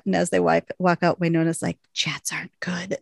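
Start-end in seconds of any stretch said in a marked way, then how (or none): 1.9-3.0 hushed, retold whisper; acted-out whispered warning; whispered aside. Retold muttered aside to sister.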